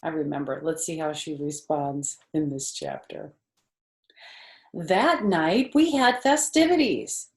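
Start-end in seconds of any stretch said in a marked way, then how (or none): 4.7-7.3 bright, lively read-aloud voice, louder; story narration. A narrator's reading. Teacher reading the book to her class, in a lively storybook voice. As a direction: read to kids with big energy.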